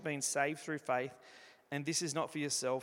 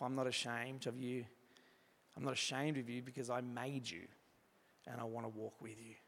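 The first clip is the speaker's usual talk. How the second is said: hushed and tender, much softer; soft voice of God; 6 dB quieter than usual. Acted. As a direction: murmur it like a loving promise. God's tender words to the listener.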